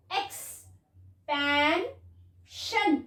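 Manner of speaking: Expansion drawn out very slowly, sound by sound; slow blended word. Shown as a demonstration. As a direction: stretch expansion for a child to follow. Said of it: "okay".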